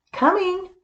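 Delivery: high sung-out call. Acted. Amelia Bedelia calls back to Mister Rogers, high call. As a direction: answer from the other room. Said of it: "called Amelia Bedelia".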